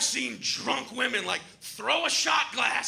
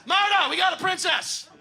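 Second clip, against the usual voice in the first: loud shout across the bar; calling out; 9 semitones higher, 5 dB louder than usual. Retold or imitated. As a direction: bellow for Marta in the back. Calling out to the back.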